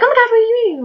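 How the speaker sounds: squealed high and loud, all fake enthusiasm; gushing friend. Mocking Sophia asking about the book, gushing and high.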